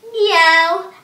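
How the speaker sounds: a big cat meow. Shown as a demonstration. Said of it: "cat sit-ups".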